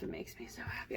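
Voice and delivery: fast excited whisper. Real, not acted. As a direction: whisper it quickly with delight.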